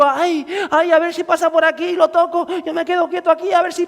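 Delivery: mock a woman sighing and just waiting; passive waiting woman. Preacher mocks the passive woman, in a high whiny voice. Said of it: "Esta mujer podía haber dicho".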